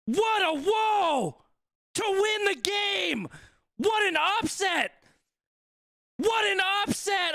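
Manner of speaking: high, elated commentator cry; ecstatic disbelief. Real, not acted. Streamer reacting live to Marcus Smith's winning drop goal, ecstatic.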